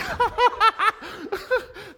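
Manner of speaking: big high laugh; high burst of laughter. Real, not acted. Preacher laughing at the nosy answer. Audience answer amuses him.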